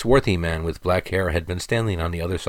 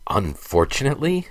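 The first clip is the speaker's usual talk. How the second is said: high, rising disbelief on Unfortunately?; incredulous retort; 7 semitones higher than usual. A narrator's reading. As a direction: throw Unfortunately? back, pitched high and questioning. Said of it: "retorted Alex".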